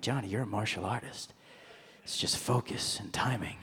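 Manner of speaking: very soft, low self-coaching; mock-wise whisper to yourself; hushed epiphany. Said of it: "this moment of clarity".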